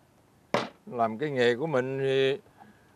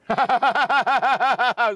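cười khà khoái chí; 8 semitones higher, 5 dB louder than usual. Acted. Cười trước chuyện cá biết nói.

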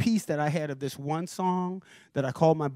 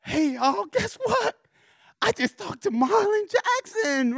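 much higher, thrilled bragging; excited announcement; slower than usual. Retold or imitated. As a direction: burst in with big news. Bragging to coworkers.

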